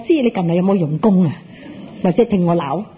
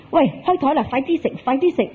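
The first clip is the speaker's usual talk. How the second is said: higher-pitched hurry-up call; impatient urging; 6 semitones higher than usual. Acted. Mahjong player phoning a friend at dinner, urging them on. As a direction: hustle a friend along, pitched up.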